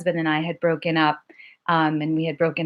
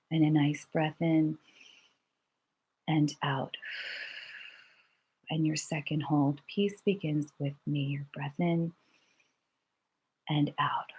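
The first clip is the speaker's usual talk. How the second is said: guided breathing; 3 semitones lower, 8 dB quieter than usual. Shown as a demonstration. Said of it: "leads a breathing exercise".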